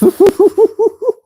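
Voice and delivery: high, loud burst of giggles; helpless real laughter; laughing fit. Real, not acted. Host cracking up over a viewer's comment, laughing. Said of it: "I'm not laughing".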